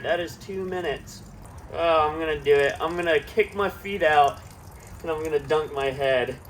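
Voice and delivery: usual speaking voice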